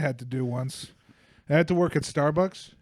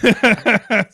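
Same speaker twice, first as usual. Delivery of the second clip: drawn-out laughing question, higher and louder; 6 semitones higher, 8 dB louder, slower than usual. Real, not acted. Barista laughing at his prank to his friend, laughing. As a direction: laugh through it, slowly, tickled by his own prank.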